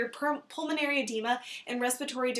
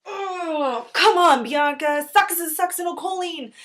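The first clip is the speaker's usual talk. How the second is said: exasperated; 6 semitones higher, 10 dB louder, slower than usual. Acted. Scolds a flustered colleague.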